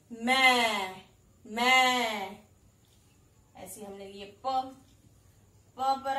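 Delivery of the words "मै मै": मै called loud, ai vowel clear; teaching the sound. Shown as a demonstration. Teacher showing म with ऐ, loud. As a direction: say मै twice for the child to echo. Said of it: "तो ये क्या हो गया?".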